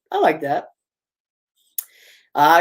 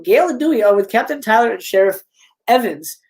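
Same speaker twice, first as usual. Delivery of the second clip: plain reading voice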